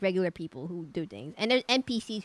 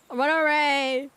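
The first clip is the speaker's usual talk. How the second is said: drawn-out cry; 4 semitones higher, 5 dB louder, much slower than usual. Acted. Dramatic farewell in game scene.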